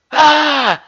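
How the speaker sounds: loud, high "Ah" shriek; imitated startled scream. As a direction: re-enact screaming at being grabbed. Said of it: "when she screamed. Like, she grabbed me".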